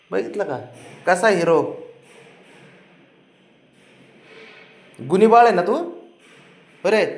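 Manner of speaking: put-on high sing-song coaxing to a toddler; baby talk. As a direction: coo and tease a sulking little boy. Parent coaxing sulking toddler.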